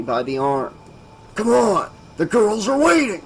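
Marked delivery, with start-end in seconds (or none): 1.3-3.2 pushy bully. Acted. Mario pushing Keith along.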